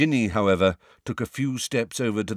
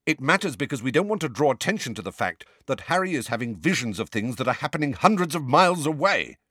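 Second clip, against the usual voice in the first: angrily; 7 semitones higher than usual. A narrator's reading. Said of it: "said Sirius angrily".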